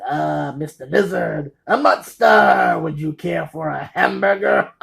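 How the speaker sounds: drawn-out cartoonish cry rising to a shout, then a laugh; loud, slow, hammed-up character impression; Pee Wee. Pee Wee to Mister Lizard in the police car, hammed-up manic shout. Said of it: "Pee Wee says".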